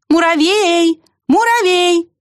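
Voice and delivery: high, sustained call; squirrel's ringing high cry. A narrator's reading. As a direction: call hopefully into the distance. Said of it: "я его зову".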